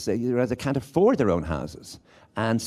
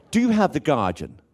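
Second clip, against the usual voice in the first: higher, lightly put-on request; newspaper buyer's line; 6 semitones higher, 4 dB louder than usual. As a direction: ask it proudly, pitched up. Repeats the grand request.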